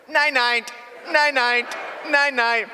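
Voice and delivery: be the parrot repeating the goodnight, shrill and loud; parrot mimicking. Parrot calls out from the back room, high and loud.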